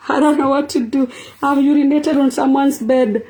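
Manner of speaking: high, wailing mock panic in a put-on voice; mock distress cry, high and put-on; panicked bedwetter. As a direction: play the horrified sleeper who wet the bed, pitched high. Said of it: "you woke up and be like".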